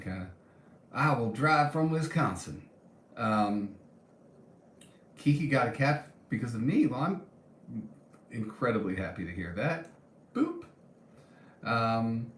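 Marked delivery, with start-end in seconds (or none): 0.9-2.6 playful Southern US accent, pitched higher. Shown as a demonstration. Vlogger replaying her accidental accent on purpose, southern accent. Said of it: "I just gave you a southern accent". 10.3-10.6 very high little boop; playful boop. Real, not acted. Vlogger booping while reading comments, playful high boop.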